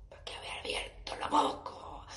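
regretful whisper to herself. Real, not acted. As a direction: mutter it under your breath.

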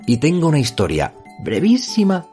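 locutor de radio, enfático y cercano; right in your ear. Acted. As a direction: presenta el programa como un locutor. Intro de podcast con música. (voz de locutor pegada al oído)

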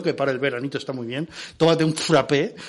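ordinary talk

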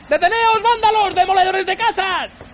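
high-pitched, outraged calling out; distant-target yelling. A narrator's reading. Arthur yelling at the men wrecking his house, outraged scream. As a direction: scream at them to stop. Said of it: "gritó Arthur".

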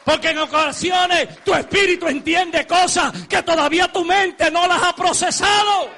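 preacher crying out to the church; full-force preaching. Acted. Preacher to congregation, shouting out a spiritual truth.

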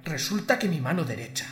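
normal talking voice

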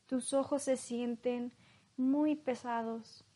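calm hypnosis guide, long pauses. Acted. Leads a hypnosis induction. (voz lenta de hipnotizador)

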